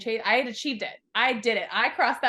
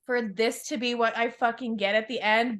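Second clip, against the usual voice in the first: louder, hammed-up indignant customer; mock complaint; 3 semitones higher, 7 dB louder, slower than usual. She protests to life that success feels awful, mock outraged yell. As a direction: complain like demanding a refund. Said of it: "I'm like".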